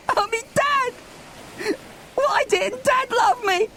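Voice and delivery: high, wailing sob for a lost dad; sobbing. Acted. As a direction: cry it out, voice breaking. Milo sobbing for his dad.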